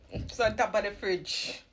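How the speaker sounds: called out. Real, not acted. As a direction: call across the room. Repeating to partner who didn't hear.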